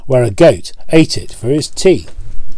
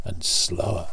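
fading whisper; 5 semitones lower, 12 dB quieter than usual. A narrator's reading. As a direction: drop to an unvoiced whisper as the train slows. Train slowing to a stop.

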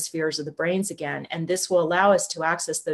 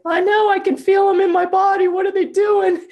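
much higher, louder panicked shout; alarmed outcry; 12 semitones higher, 6 dB louder than usual. Retold or imitated. Lynn calling to the translator mid-session, panicked shout. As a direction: cry out in alarm at what you feel.

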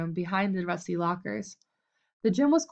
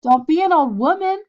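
taunting; 8 semitones higher, 9 dB louder than usual. A narrator's reading. Red taunting Bud.

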